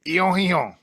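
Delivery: ion said high and loud as a French drill; mock French ion. Shown as a demonstration. Teacher drilling the group to sound French, high and loud. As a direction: belt out ion, ion like a language teacher. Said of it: "Repeat after me".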